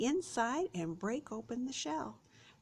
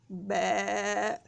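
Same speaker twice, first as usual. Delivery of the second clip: short bleating baa; sheep bleat; 5 dB louder than usual. Shown as a demonstration. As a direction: bleat like a lamb for the kids. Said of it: "What does the sheep say".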